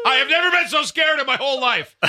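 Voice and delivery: high, loud panicked cry; scared shout. Retold or imitated. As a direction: yell it like you're terrified. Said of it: "in a way that said".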